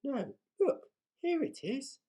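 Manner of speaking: high excited cry; excited pirate girl. A narrator's reading. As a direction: Peg finds the chest and calls out. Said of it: "cried Peg Polkadot".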